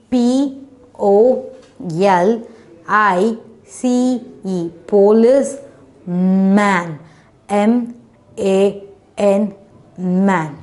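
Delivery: "Police" and "man" spelt out with gaps, each then said whole; syllable-by-syllable spelling. Shown as a demonstration. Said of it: "We just divided into two syllable".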